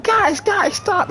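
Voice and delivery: high, shouted pleas to stop; girlfriend pleading. Retold or imitated. Retelling how the girlfriend begged the fighters to stop, high shout. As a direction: squeal at them to break it up.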